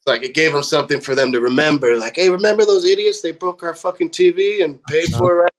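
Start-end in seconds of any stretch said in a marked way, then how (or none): 2.2-5.5 high, lively imitation of someone reminiscing; animated recollection. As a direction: mimic retelling a crazy story, pitched up. Imagined crew recalling the band.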